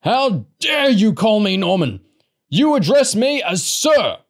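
high, loud, indignant roar; outraged growl. A narrator's reading. Man scolding a boy for familiarity, outraged. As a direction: roar with wounded authority. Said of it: "Large growled".

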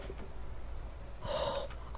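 soft voiceless gasp; long frightened intake of breath; startled gasp. Real, not acted. Player interrupted by game scare, startled gasp.

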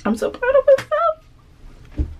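high, slow, proud squeal; excited squeal. Real, not acted. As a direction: squeal it out with pride. Squeal of pride.

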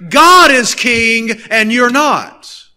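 normal talking voice

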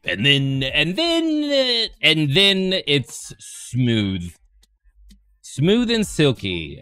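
silly put-on voice.

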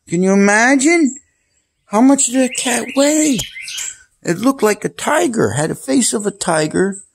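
astonished retelling of a giant cat; amazed. Real, not acted. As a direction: wow them with how big it was.

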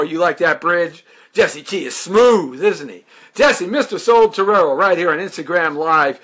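ordinary talk, nothing marked

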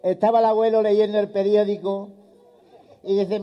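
normal talking voice

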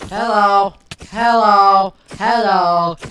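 loud mock-friendly shout; yelled hellos. Real, not acted. Shouting hello at a skeleton in the cave. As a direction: yell hello at the monster. Yelling greeting at mob.

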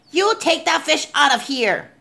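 angry crowd yell. A narrator's reading. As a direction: yell it as an indignant order. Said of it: "they yelled".

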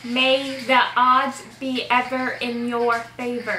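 slow, word by word, own accent; slow lip-reading prompt. Shown as a demonstration. Player repeating the line to a lip-reader, slow and deliberate.

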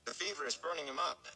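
high, quick worried plea; anxious urging. Acted. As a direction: hurry them along, fretting. Rand urges them to hurry.

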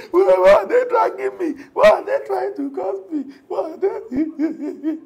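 high, loud whiny complaining voice; mock whiner. Preacher mocking a church member who whines about attacks, high whiny voice. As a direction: whine like a self-pitying victim.

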